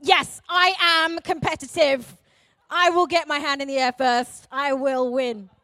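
loud, emphatic mock declaration; fiercely competitive. Competitive people in the house volunteering, loud and determined. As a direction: boom it out like an eager competitor. Voicing keen competitors in the audience.

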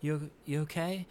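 high, hushed coaxing to an animal; talking to a pet. Retold or imitated. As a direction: coax it like a scared puppy. Coaxing a caged monster.

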